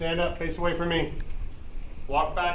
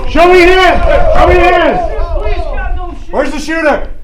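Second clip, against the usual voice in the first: loud slow barked orders; shouting commands; 10 semitones higher, 15 dB louder, much slower than usual. Acted. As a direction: yell it like a cop clearing a room. Drill officer confronting crowd.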